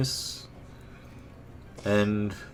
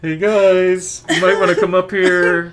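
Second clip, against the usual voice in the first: calling for help; 10 semitones higher, 9 dB louder than usual. Pretend. Voicing the character calling for help.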